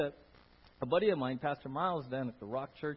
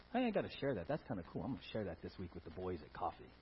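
casual aside; 9 semitones lower, 7 dB quieter, faster than usual. Retold or imitated. Voicing casual churchgoer.

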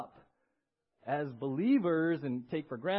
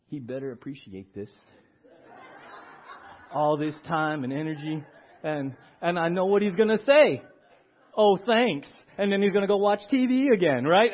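grumbling spouse; 8 dB louder than usual. Retold or imitated. Grumbling about ungrateful husband.

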